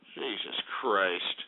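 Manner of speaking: low, slow, quiet exasperation; disgusted aside. Real, not acted. Ranting host fed up with the band, low disgusted mutter.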